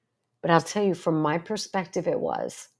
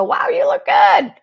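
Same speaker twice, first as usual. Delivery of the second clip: high, loud, gushing shout; excited compliment; 10 dB louder than usual. Retold or imitated. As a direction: rave about how good she looks. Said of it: "come up to me afterwards and go".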